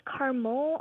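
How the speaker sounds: caramel squeezed into two syllables, CAR-mul. Shown as a demonstration. Young caller asking the hosts which is right, caramel as CAR-mul. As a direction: say caramel as KAR-muhl, middle vowel dropped. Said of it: "Is it pronounced".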